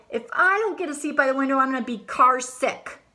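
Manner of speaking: whiny complaint. Acted. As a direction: demand the window seat. Alexander's complaint in carpool.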